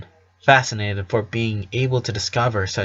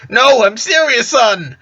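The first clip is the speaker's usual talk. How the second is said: high, loud, excited protest; amused insistence; 12 semitones higher, 5 dB louder, slower than usual. A narrator's reading. Father insisting to his doubting son, amused and excited. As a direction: push the pitch right up as you insist.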